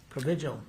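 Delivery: ordinary talk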